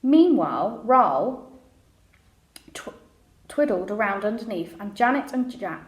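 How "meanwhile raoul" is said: plain reading voice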